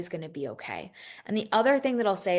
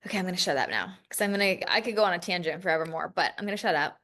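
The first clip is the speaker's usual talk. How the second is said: usual speaking voice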